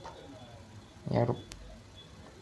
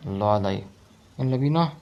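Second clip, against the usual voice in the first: usual speaking voice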